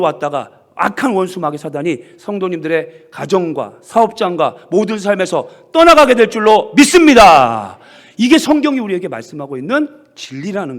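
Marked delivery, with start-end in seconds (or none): 3.1-7.8 크게, 믿습니다를 느리게 끌며; 확신을 담아 힘껏 선포. Real, not acted. (회중에게 크게 축복을 선포)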